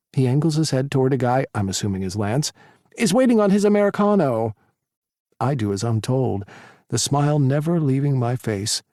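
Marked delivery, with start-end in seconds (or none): 3.0-4.5 bossy sassy voice. Acted. Tyler bossing the narrator.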